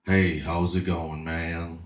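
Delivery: lightly voiced low, unhurried caller; [name]. Surprise caller answers his online date, lightly voiced low old man. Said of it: "You don't sound like a thirty-two-year-old mud and blonde".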